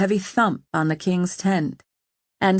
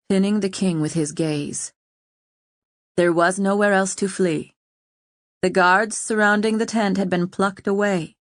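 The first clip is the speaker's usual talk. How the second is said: plain reading voice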